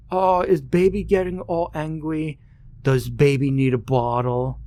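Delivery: put-on high, sing-song taunting baby voice; mocking baby talk. Bully on the bus mocks crying Ben, high baby-talk taunt. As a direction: coo at him like he's a toddler, to sting.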